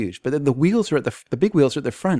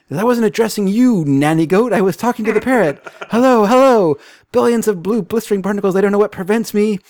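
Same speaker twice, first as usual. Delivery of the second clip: captain's fury; 8 semitones higher, 5 dB louder than usual. Acted. Captain shouting angrily at phone.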